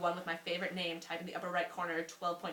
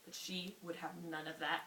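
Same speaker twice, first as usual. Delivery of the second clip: ordinary delivery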